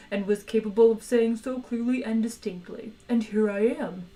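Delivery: the cheerful Dish of the Day selling its own body; talking meat animal. Acted. Eager talking cow offering itself to diners, polite creature voice.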